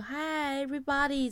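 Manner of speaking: high, drawn-out classroom hello; English teacher greeting. Retold or imitated. Greeting the whole department, like an English teacher. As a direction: greet a whole class, stretching each word. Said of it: "你怎么那么像英".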